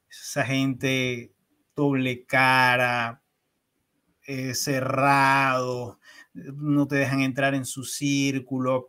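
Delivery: muy despacio, marcando cada palabra con desprecio; lento y despectivo. Retold or imitated. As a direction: recita los prejuicios con calma pesada. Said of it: "me cuentan la historia". (despacio, contando los prejuicios con desprecio)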